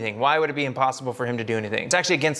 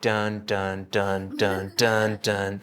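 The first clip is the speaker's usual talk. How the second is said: low, dark dun dun of doom music; mock movie dread; ominous music hum; 7 semitones lower, much slower than usual. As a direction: hum the foreboding score deep and low. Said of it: "you're hearing the music of the foreboding".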